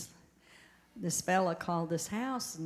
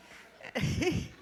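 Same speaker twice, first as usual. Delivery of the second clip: breathy rising giggle; short high giggle; 9 semitones higher than usual. Real, not acted. Laughing at her own joke.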